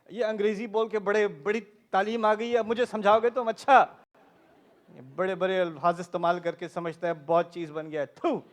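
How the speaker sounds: scornful Urdu rant, fast and forceful; indignant parent. Retold or imitated. Urdu-speaking parent mocking their English-speaking child, indignant rant. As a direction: imitate a scolding parent, rattling it off.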